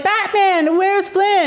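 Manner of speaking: put-on high, playful grown-up-to-child voice; playing along. Grandma pretending not to know her grandson, playfully amazed. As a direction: feign wonder in a high pitch. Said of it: "you have to be like".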